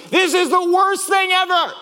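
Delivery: high, drawn-out mock shout; hammed-up outburst of doom; catastrophizing. Driver overreacting to a scratched bumper, hammed-up catastrophizing shout. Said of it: "my mind immediately went to".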